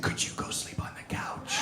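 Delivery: hushed, very soft request; whisper. Retold or imitated. Partner asks suffering man to leave bed, whispering. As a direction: imitate an unsympathetic partner whispering.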